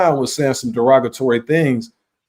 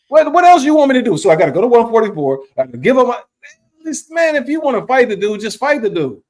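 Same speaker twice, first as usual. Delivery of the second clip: high, loud fast exasperation; exasperated rant; 9 semitones higher, 3 dB louder than usual. Real, not acted. Host ranting about a belt demand, high loud exasperation.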